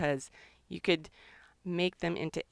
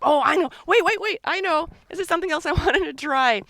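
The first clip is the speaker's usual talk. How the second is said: excited; 10 semitones higher, 8 dB louder, faster than usual. Real, not acted. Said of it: "I get so mixed up".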